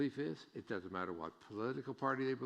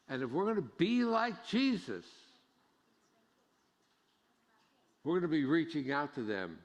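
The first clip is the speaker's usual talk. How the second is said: louder, higher preaching, slowing on the key lines; full-force preaching; 6 semitones higher, 6 dB louder than usual. Acted. Pastor urging the congregation to accept outsiders, preaching hard. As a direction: drive the point home from the pulpit.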